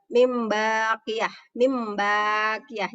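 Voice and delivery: long hummed m in "mim" before baqiyah; slow recitation, m held in "mim baqiyah"; ba read as mim. Shown as a demonstration. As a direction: draw out the m of "mim", then release into baqiyah. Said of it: "kayak ada huruf mimnya".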